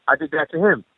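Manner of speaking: ordinary delivery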